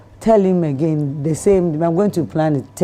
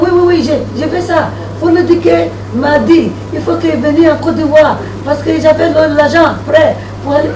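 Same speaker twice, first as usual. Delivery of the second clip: much higher, louder eager French; eager French buyer; 11 semitones higher, 7 dB louder than usual. Retold or imitated. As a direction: gush yes in French, excited. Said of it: "I was like".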